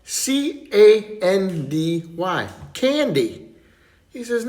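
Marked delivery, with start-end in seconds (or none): 0.0-3.4 slow, each letter spelled out; letters spelled out slowly, then the word. Acted. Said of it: "spelling out a word for Santa".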